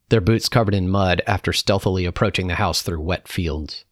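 usual speaking voice

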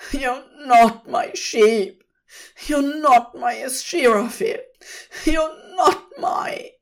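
escalating high, loud protest; acted desperate shout, breaking off unfinished; distressed cry. A narrator's reading. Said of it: "Crowley cried".

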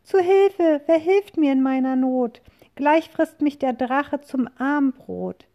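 very high, loud call for help; cry for help. A narrator's reading. Witch crying for help before the dragon, high and loud. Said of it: "heraus kommt ein Schrei".

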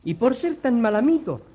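high, loud rebuke; stern scolding. Acted. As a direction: scold him sharply. Gnome scolds the liar.

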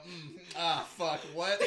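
startled realization. Retold or imitated. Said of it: "It goes".

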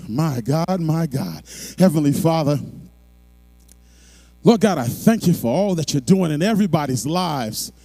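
fervent, swelling prayer; closing prayer. Acted. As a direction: pray over the whole congregation.

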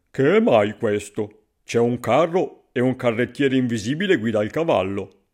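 loud, baffled passer-by; lightly voiced man marvelling at the sight; astonished stranger. A narrator's reading. Stranger puzzles over invisible driver. (forestiero sbalordito, voce forte)

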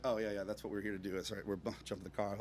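low, quick, soft mumble; deflated retold agreement under the breath; sheepish backtracking. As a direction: mumble hastily to cover embarrassment. Sheepish relief, covering fear.

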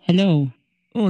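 normal talking voice

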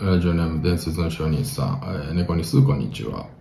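low, soft, deflated letter read; low-energy, off-mood reading. Acted. Radio host rereading a letter after lost recording, dejected. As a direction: read the listener's name as if all energy has gone. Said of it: "あれ、様子がおかしいな。".

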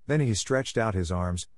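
ordinary delivery

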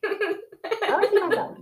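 high, giggly repeat; laughing. Real, not acted. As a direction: giggle through the words. Laughing at own image.

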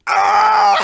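high, loud thrilled scream; excited fan scream. Retold or imitated. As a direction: squeal like a fan in the cinema. Fan's excitement at scene.

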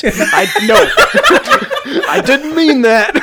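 high, loud, slow, laughing through it; embarrassed laughter, caught out; laughing denial. Real, not acted. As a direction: laugh while taking it back.

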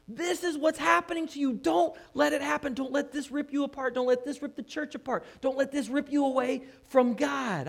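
high, loud, exasperated outburst; frustrated shout. Retold or imitated. James shouting at quarrelling believers, frustrated and loud. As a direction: shout it like a preacher who can't believe his flock. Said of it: "I think he's shouting".

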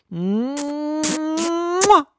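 high, loud smacking kiss; kiss sound. Shown as a demonstration. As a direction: pucker up and smack a big kiss. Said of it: "Like that?".

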